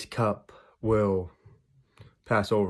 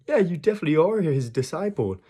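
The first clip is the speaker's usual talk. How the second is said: high-pitched insistent onlooker; third accuser; 8 semitones higher, 4 dB louder than usual. Retold or imitated. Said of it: "And then a third person says".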